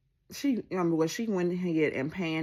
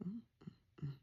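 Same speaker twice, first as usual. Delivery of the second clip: ordinary talk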